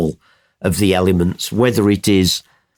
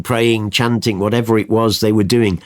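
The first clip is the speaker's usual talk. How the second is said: usual speaking voice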